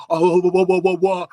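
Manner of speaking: high, loud "wah wah" babble; mocking babble. Man mocking his teenage boasts, loud babble. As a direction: send up your own bragging as noise. Mocks own boastful chatter.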